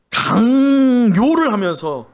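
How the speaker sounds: 강요를 drawn out slow and punched loud, pitch up; stress on forcing. Real, not acted. Pastor stressing the word 강요를 to the congregation, slow and loud.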